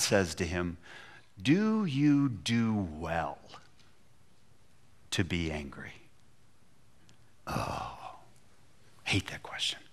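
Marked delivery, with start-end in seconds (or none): 1.4-5.9 very slow and low, fading soft; slow probing question. Acted. God asks Jonah a question. 7.5-9.8 soft breathy whisper of dread; whispered groan. Real, not acted. Preacher reacts to God's question.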